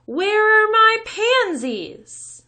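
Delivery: very loud, high, slow roar; angry mother screaming about her flowers; furious shout. A narrator's reading. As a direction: bellow across the whole town. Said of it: "hear her all over town".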